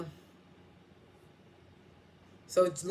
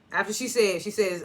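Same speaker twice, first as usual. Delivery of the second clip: ordinary talk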